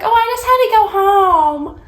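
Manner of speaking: high, airy offhand reply; breezy roommate. Retold or imitated. Imitating the roommate's carefree excuse, high and airy.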